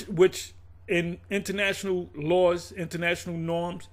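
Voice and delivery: ordinary talk, nothing marked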